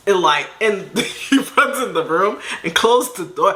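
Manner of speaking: ordinary delivery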